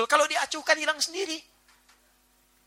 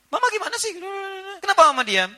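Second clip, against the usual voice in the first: mock angry husband; 12 semitones higher, 4 dB louder than usual. Angry husband voiced at quiet wife.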